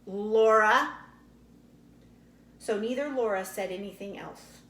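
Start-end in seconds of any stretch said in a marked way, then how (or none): 0.1-1.0 stern, louder warning call of the name; severe. A narrator's reading. Ma stopping the quarrel, severe warning. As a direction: scold her with one word. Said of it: "said severely".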